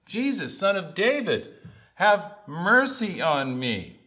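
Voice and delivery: high, drawn-out plea for mercy. Retold or imitated. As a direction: call out as if to be heard over a crowd. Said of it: "He screams louder".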